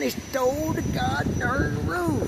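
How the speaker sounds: high, drawn-out wail of alarm; high hammed-up alarm; mock outrage. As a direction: cry it out slowly in a high put-on voice. Opens mock-shouting about the missing roof.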